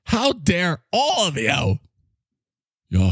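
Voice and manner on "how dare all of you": higher, slow, drawn-out mock outrage; hammed-up climate-speech scolding; Greta Thunberg voice. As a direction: stretch each word like a scolding activist parody. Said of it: "now you sound like Greta Thunberg".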